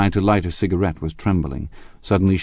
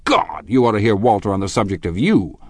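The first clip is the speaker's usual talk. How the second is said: loud, high, swooping attack; angry accusation; 5 semitones higher, 5 dB louder than usual. A narrator's reading. Tom accuses Gatsby of betraying a friend, angrily. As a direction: hammer him with scornful charges.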